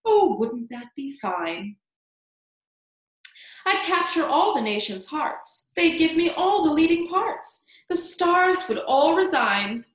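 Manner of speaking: high, loud, dreamy boasting; grand, self-admiring rhyme; vain Aunt Sponge. A narrator's reading. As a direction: declaim your dreams of stardom.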